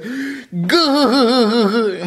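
a high silly monster noise; goofy creature grunt. Retold or imitated. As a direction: grunt it like a cartoon oddball. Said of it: "He's like".

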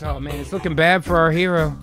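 usual speaking voice

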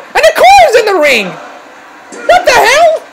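high, incredulous commentary yell; shocked outburst. Real, not acted. Commentator sees an intruder in the ring, shocked outburst. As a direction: blurt it out in disbelief.

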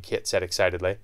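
ordinary delivery, nothing marked

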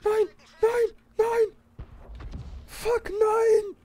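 high, loud cries of no; panicked shouting. Real, not acted. Tank breaks down in game.

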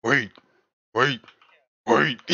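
separate, evenly repeated one-word announcements; crosswalk signal voice. Retold or imitated. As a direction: imitate a crosswalk sign's repeated wait. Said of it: "Hey, the crosswalk sign".

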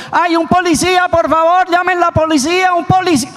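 high, frantic plea for a policeman; panicked cry for police. Acted. Someone being mugged begs passersby for police, frantic high cry. Robbery victim begging for police.

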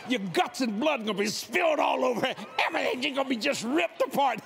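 preacher warning the atheist, fiery. Retold or imitated. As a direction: thunder it like hellfire.

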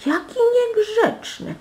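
very high, drawn-out outrage; indignant princess. A narrator's reading. Princess hearing the rude demand, indignant. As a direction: squeal it slowly in offended shock.

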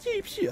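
high, squeaky "pew pew"; mimicked laser shots. Player mimicking an attack on a teammate, high pew noises. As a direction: imitate quick laser fire in a high pitch. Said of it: "Oh, he's like".

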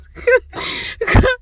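silent high wheeze of laughter; breathless wheezing laugh. Real, not acted. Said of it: "The whole situation is just so funny".

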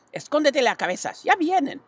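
put-on high, urgent old sheep voice; urgent sheep warning. A narrator's reading. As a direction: hiss a warning as the truck comes. Sheep warns Wilbur.

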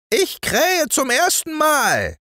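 very high, crowing rooster voice; rooster calling down. Acted. Golden rooster calling down to the boy, high crowing voice. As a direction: crow it down from the bell tower. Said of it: "rief der goldene Hahn herunter".

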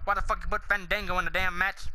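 ordinary delivery

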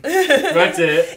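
high, loud, laughing through the words; laughing exclamation. Real, not acted. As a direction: burst out laughing as you say it. Laughing at Megan's spelling.

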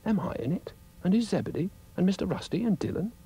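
high-pitched, hopeful questions in character; eager Florence. Acted. Florence asking Dougal if she is in it, eager. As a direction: ask eagerly in a high little voice.

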